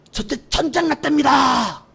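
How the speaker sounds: high, slow, loud shouted report; panicked shout. Retold or imitated. Private shouting the war report to officer. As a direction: yell the alarm, drawn out.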